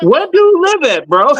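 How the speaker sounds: a high, teasing question; high mock question. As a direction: squeak it up high.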